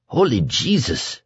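thin, high, frightened exclamation; suddenly thin voice. A narrator's reading. The kid sees what is coming, voice gone thin. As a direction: let the bluster drain into a thin gasp. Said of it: "his voice was suddenly thin".

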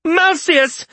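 high, loud scolding yell; yelled name. A narrator's reading. Isaria yells at Malseus to silence him, high and loud. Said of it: "yelled Isaria".

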